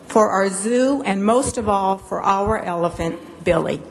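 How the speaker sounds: usual speaking voice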